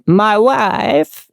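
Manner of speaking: a hint of Borat saying my wife; quick mock Borat accent; Borat impression. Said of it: "a Borat impression".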